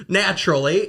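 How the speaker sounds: ordinary delivery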